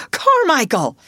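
"Carmichael!" flung out high and loud; desperate shout. A narrator's reading. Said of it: "cried out loudly".

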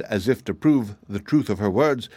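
plain reading voice

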